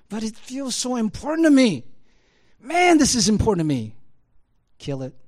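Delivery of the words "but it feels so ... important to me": mock self-pleading. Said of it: "That feeling you get".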